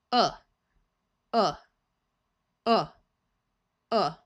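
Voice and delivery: Uh said short and relaxed; short oo sound. Shown as a demonstration. Teacher repeating the short O O sound, short and relaxed. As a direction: clip Uh as a short central vowel. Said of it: "The short O O sound of uh".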